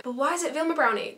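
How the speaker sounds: ordinary delivery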